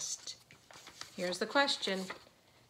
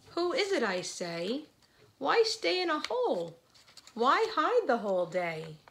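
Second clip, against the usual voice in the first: put-on animated read-aloud to children; 2 semitones higher, 5 dB louder, slower than usual. A narrator's reading. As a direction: build the bear's questions with eager, swooping pitch. Reads a picture book aloud.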